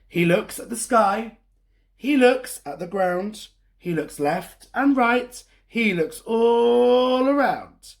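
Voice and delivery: lively, sing-song storybook reading; reading to children. A narrator's reading. Storyteller reading a picture book to kids. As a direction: read it like story time for little ones.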